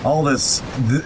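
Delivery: normal talking voice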